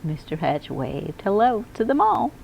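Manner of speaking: plain reading voice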